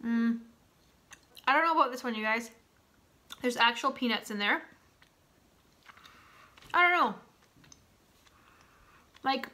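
talking between bites; chewing while speaking; mouth full, chewing. Real, not acted. Seen 1: Full cheeks and chewing as she speaks, bites bar again mid-speech.

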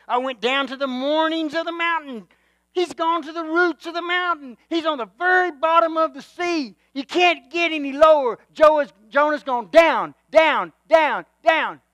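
impassioned descent preaching; dramatic preaching. Acted. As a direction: paint Jonah sinking, down, down, down.

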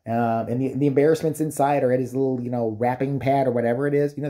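ordinary talk, nothing marked

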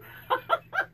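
airless, very high giggle; a helpless wheezing giggle; breathy high laugh. Real, not acted. Amused by spirit's joke.

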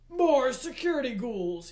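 loud, aggrieved complaint; Hades moaning. A narrator's reading. Hades lists the troubles of his kingdom, moaning. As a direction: moan loudly over mounting burdens. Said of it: "he moaned".

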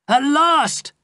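high, loud triumphant shout; the hero finally catching his enemy. A narrator's reading. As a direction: yell it out in triumph. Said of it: "I shouted".

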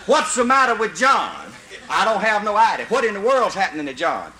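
ordinary delivery

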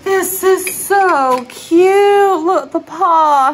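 high, slow, loud gushing; gushing cashier. Retold or imitated. Checkout lady cooing over the paw coat, gushing. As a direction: coo over it like a delighted cashier. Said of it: "And she was like".

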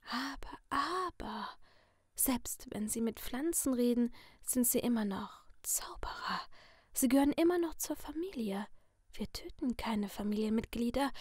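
sinister masked figure in a soft whisper; menacing whisper from behind a mask; creepy whisper. Acted. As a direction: purr the threat like a friendly killer. Masked Letty speaks in whisper.

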